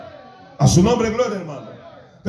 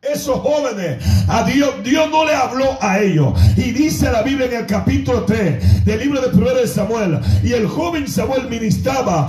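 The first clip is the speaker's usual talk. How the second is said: fervent scripture preaching, each phrase pushed out; full-force preaching; 5 semitones higher, slower than usual. Real, not acted. Preacher telling of young Samuel ministering, full-force preaching. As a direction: declaim the passage to a congregation.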